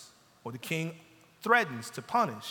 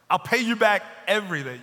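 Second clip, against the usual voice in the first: a louder, higher begging promise; mock debtor's plea; imitating a plea; 5 semitones higher, 7 dB louder than usual. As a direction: plead like the debtor. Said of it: "he's making a plea".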